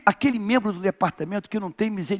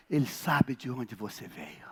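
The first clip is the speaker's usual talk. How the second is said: whispered, much softer; 9 semitones lower, 11 dB quieter than usual. Real, not acted. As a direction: breathe it to the congregation. Whispered repeat.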